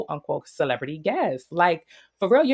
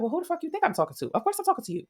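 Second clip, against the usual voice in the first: high, rushed comeback, imitating her; imitated indignant clapback; mock indignant retort; 11 semitones higher, much faster than usual. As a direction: fire back in a high-pitched mock challenge. Retelling Tinker's angry retort.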